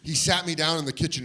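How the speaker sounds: usual speaking voice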